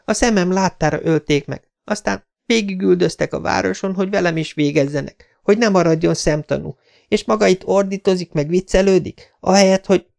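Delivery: magas hangú, feldúlt lány panasza; felindult, majd elcsukló. Acted. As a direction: sorold feldúltan, magasan, majd hirtelen akadj el. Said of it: "Hirtelen elcsuklott a hangja". (felindult, a végén elakadó hang)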